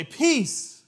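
high, very loud single shout; shouted command. Retold or imitated. Lead singer silencing the band's chaos, shouted command. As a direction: cut through the chaos with one big cry. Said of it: "the lead singer would say".

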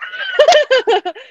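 a high burst of laughing shriek. Real, not acted. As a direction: laugh out in a loud high squeal. Shrieking laugh during the story.

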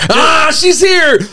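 loud, high, drawn-out shout of welcome; excited greeting. Real, not acted. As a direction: cry out that your friend has arrived. Friend turns up mid-teasing.

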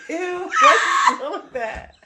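high, loud, drawn-out disgust; exaggerated "ew" at a nasty smell, slow and high; mock disgust. Recalling sniffing her finger, loudly grossed out.

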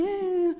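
mock wail. Victim while the man just stood there, imitated high wail. Re-enacting own wail during the fight.

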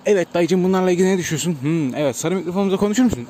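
loud street reporter with a mic; street interviewer. Pretend. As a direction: push the yellow mic at a passer-by. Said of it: "YouTuber'a benzemedim mi ha, sokakta böyle çekim yapanlar".

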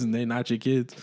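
normal talking voice

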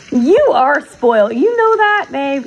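slow, loud, teasing reproach; mock scolding. Real, not acted. Telling her pet he is spoiled, loud playful scolding. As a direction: pin him down, affectionately stern.

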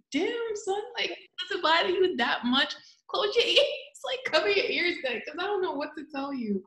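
high-pitched teasing, laughing through it; teasing mock scolding. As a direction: needle him in a high, amused voice. Teasing Julian about accents.

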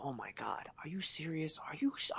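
breathless shocked whisper under his breath. Retold or imitated. Walking in late to the meeting, whispering in shock. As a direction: hiss it so the room can't hear. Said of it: "I go".

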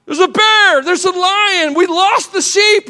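high, loud panicked shepherd; mock panic. Shepherd panics over bear and lion, hammed-up alarm. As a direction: cry out as if sheep are gone. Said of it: "all of a sudden".

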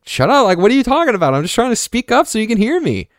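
mock bratty kid snapping back at mom; indignant kid retort. Kid retorting to mom's scolding.

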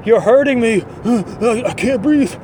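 high, whiny fake-hurt complaining; hammed-up suspect faking pain; mock pained whine. Suspect whining to arresting cop, hammed-up pained whine. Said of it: "he says things like".

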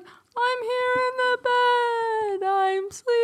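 high, loud, long-drawn wail; ghostly daughter. Acted. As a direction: float each word out like a ghost. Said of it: "what the fuck was with the voices?".

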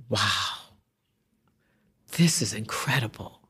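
put-on hushed, awed murmur of an old woman; hushed awe. An older woman whispers in front of a painting, hushed awe.

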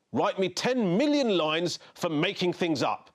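high, slow, emphatic scolding; indignant head teacher. A narrator's reading. Head teacher punishing Henry for making things up, indignant. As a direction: hand down the punishment with drawn-out indignation.

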